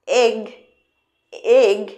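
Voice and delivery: loud এগ with an open e; egg demo. Shown as a demonstration. Teacher calling out এগ for the child. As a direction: say এগ loudly with a short e. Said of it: "এ কী দিয়ে শুরু হয়".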